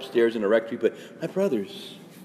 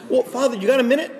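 much higher, quick plea for a minute; pushy parishioner; 11 semitones higher, 3 dB louder, faster than usual. Retold or imitated. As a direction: corner the priest with a request. Parishioner voiced asking favour.

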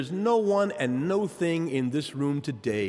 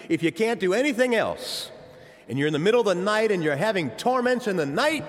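ordinary delivery

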